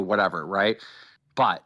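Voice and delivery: ordinary talk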